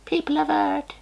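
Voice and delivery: very high squeaky alien conqueror; arrogant alien overlord. Pretend. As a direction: play a tiny alien claiming Earth. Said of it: "sorry about that voice".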